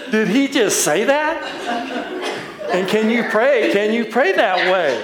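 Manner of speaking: high-pitched, laughing through the words; can barely get it out for laughing; real laughter breaking through the question. Real, not acted. Laughing at the memory.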